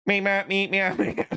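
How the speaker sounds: high playful meows; cat meowing. Pretend. Vlogger meowing like a cat to the viewers. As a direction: meow like a cat for fun.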